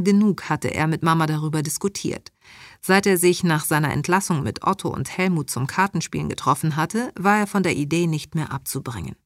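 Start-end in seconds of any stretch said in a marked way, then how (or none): none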